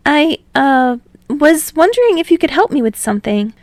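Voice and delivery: high, loud, halting and bashful; nervous, stumbling request; shy young man. A narrator's reading. Hinata voiced, uncomfortable.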